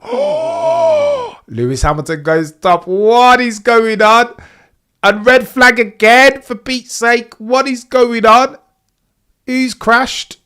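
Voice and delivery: high, loud shout of disbelief; shocked shouting. Real, not acted. Streamer yelling at the red flag and Hamilton going top.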